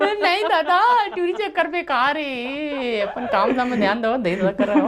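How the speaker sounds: slow, high comic voice; teasing in a squeaky voice; high put-on voice. Mock advice in put-on voice.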